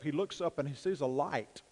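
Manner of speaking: ordinary talk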